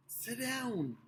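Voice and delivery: ordinary delivery, nothing marked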